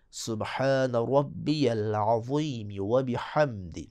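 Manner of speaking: slow tajweed recitation of سبحان ربی العظیم و بحمدی; measured Quranic-style recitation; careful recitation. Shown as a demonstration. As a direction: recite the whole phrase slowly. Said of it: "full corrected recitation".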